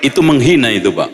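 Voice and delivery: usual speaking voice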